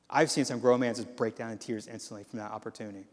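normal talking voice

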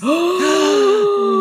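high, loud horrified gasp; shocked gasp. Retold or imitated. Hearing the washer disaster, loud high horrified gasp. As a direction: gasp as if struck by bad news.

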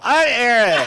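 high, loud, slow dopey voice; laughing dim-witted rich kid voice; Eric Trump impression. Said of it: "that is my new favorite impression of yours".

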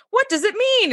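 mock confused actor. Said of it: "this poor actor is like".

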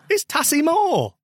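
Tassimo said slow and high in a hammed-up Italian accent, stress on the middle "si"; mock Italian Tassimo. Teasing his co-host with a hammed-up Italian Tassimo. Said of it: "Mister Italian".

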